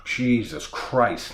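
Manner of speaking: ordinary delivery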